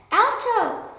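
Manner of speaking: high, loud cry to halt; exclaiming. A narrator's reading. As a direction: shout stop before the boot falls. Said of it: "exclaimed the little red ant".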